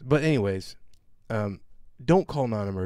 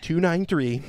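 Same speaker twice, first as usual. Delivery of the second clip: raised, loud reading out plates; mock reading off; 4 semitones higher, 3 dB louder than usual. Imitating the manager reading plates to 911, raised. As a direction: read it out like a tattling manager. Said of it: "she's, like, reading off".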